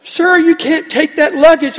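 high, loud and slow objection; protesting clerk. Retold or imitated. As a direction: play the clerk calling after him to stop. Clerk scolding in story.

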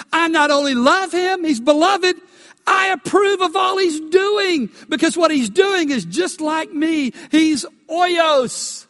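high, loud, near-shouting proclamation; God's proud approval. Acted. Preacher voicing God the Father to the congregation, loud and exultant. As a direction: thunder out the Father's pride in his Son.

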